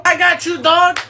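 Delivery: usual speaking voice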